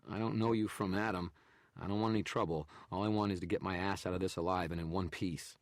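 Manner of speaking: just wants out alive; desperate plea to be left alone; scared photographer. A narrator's reading. Narrator voices photographer.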